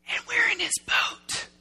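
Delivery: frightened stage whisper; hushed, voiceless dread; awed whisper. Retold or imitated. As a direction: whisper it in stunned realisation. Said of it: "'Cause they're going".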